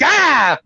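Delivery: high, loud imitated "gah"; scare yell. Husband jumping round the corner at wife, scare yell. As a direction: jump out with a sudden mock yell. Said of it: "Scared the fuck out of her".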